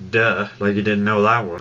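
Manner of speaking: normal talking voice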